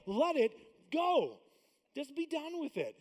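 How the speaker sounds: very slow, loud let it go; drawn-out command. Real, not acted. Pastor telling the congregation to release, slow and loud. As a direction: stretch every word out.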